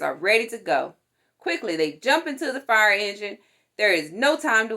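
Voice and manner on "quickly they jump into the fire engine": plain reading voice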